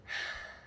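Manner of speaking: a long breathy bored sigh; big sigh. Acted. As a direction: let all the boredom out in one sigh. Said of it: "I did a big sigh".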